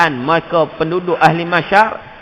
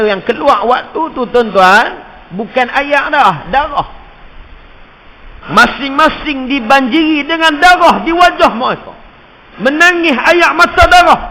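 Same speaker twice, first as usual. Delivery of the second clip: high, loud climactic sermon; impassioned preaching; fiery preaching; 10 semitones higher, 8 dB louder than usual. Acted. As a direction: build to the cry of blood. Preaching at full force.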